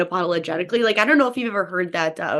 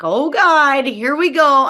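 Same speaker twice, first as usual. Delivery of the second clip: raised, louder inner groan; dreading sigh; 6 semitones higher, 7 dB louder, slower than usual. Retold or imitated. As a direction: brace for trouble. Anticipating judgment, inner voice.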